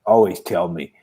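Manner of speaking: ordinary talk, nothing marked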